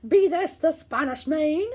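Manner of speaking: pirate captain hollering at the shore; pirate yell. Acted. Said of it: "climbed out of the boat and yelled".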